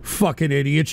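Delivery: ordinary talk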